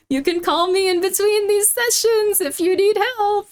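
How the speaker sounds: eager therapist. Retold or imitated. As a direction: sound keen to help. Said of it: "And she's like".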